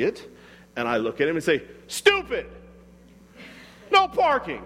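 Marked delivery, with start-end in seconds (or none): 1.9-4.6 much higher, a sharp scornful outburst; condemning yell. Retold or imitated. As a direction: bark it at the driver like he's an idiot. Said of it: "I'm condemning".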